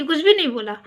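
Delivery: ordinary delivery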